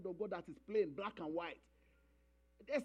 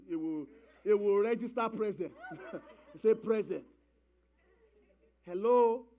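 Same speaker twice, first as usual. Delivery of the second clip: normal talking voice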